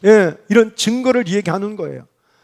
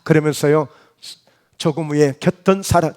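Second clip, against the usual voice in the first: usual speaking voice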